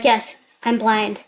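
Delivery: ordinary talk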